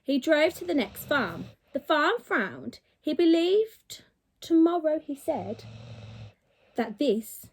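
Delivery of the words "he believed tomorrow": plain reading voice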